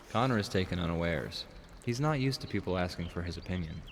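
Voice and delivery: usual speaking voice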